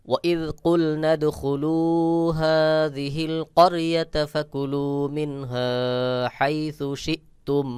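very slow, high melodic recitation; Quran recitation. Shown as a demonstration. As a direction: chant the verse, stretching each vowel. Recites the verse after spelling. (ধীর সুরেলা কুরআন তেলাওয়াত)

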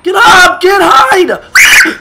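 frantic screaming; panicked scream. Real, not acted.

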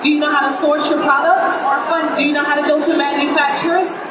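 ordinary delivery

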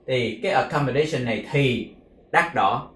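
ordinary delivery

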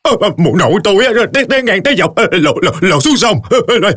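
high, fast, stammering drowned ghost; eerie ghost voice. Acted. The drowned woman's ghost answers her neighbor, high and stammering. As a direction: stutter it out like a waterlogged ghost.

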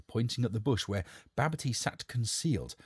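ordinary delivery, nothing marked

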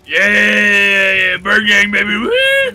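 loud victorious shout; cheering shout. Real, not acted. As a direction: hype it up.

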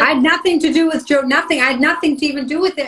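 a real forceful denial; emphatic insistence. Real, not acted. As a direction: push back firmly, nothing to do with it. Insisting she had no part.

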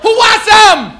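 very high, very loud, hammed-up shouting; drunk bar brawler shout. Comic mocking a drunk guy in a bar, hammed-up shouting. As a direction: yell like a drunk picking a fight.

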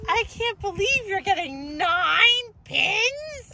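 high, slow fake wonder; mock amazement. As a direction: act wildly impressed. Mom mock-amazed at daughter.

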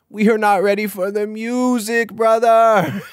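high, loud cry of excitement; hyped-up shout. Real, not acted. Repeats it in loud hype.

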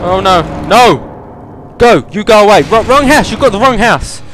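high, loud panicked yells; panicked shouting. Real, not acted. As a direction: shout him away in panic. Chased in game.

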